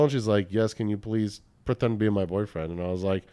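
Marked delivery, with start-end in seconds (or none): 0.5-2.7 imitated Russian woman's accent; a hint of a Russian accent. Woman asking a stranger to pretend to be her boyfriend, Russian accent. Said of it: "thick Russian accent".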